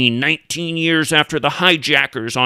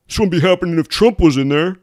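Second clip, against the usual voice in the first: mock supporter; 6 semitones higher, 4 dB louder than usual. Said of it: "they can only see the other party's".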